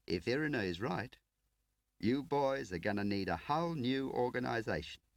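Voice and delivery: brash, grinning field man; cocky agent Tar. Acted. Tar addresses all three.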